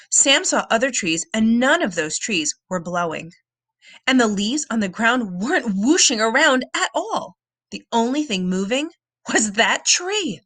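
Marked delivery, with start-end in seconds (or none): none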